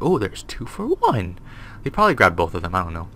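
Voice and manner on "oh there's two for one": slow, lightly voiced hungry monster; a hint of a greedy creature, drawn out; mock monster savouring a meal. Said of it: "He's like".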